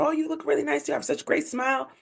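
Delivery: high, soft admiring voice; imitated compliment. Stranger complimenting her smile outside the relationship, imitated admiration. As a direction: lightly mimic someone gushing, high and quiet.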